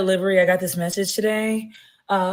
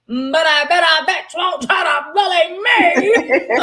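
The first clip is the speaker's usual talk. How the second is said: laughing shout; 7 semitones higher, slower than usual. Shown as a demonstration. Repeating her own line as a laughing shout. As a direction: belt it out again, laughing.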